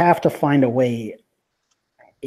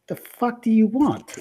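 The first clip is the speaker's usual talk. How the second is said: angry woman voice; 10 semitones higher than usual. Acted.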